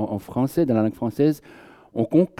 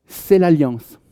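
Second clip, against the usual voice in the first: Jesus speaking; 4 semitones higher, 5 dB louder than usual. Retold or imitated. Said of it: "et puis il dit".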